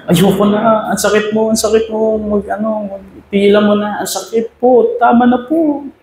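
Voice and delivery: high, pleading whimper of hurting clay. A narrator's reading. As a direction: beg to stop like crushed clay crying. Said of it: "sabi ng putik". (mataas at nagmamakaawang boses ng putik)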